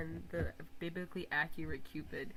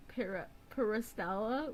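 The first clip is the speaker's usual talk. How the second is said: ordinary delivery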